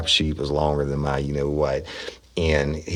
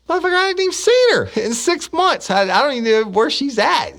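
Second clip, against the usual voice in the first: high, louder indignant outburst; officer snapping at his supervisor, high and loud; mock outrage; 5 dB louder than usual. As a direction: fire it back in outrage, pitched way up. Retold outraged reply to supervisor.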